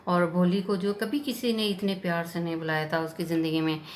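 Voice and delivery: ordinary delivery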